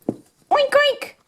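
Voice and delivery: high, squeaky little oinks; piggy oink. Pretend. Runaway toy pig taunting, high squeaky oinks. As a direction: oink like a cartoon piglet.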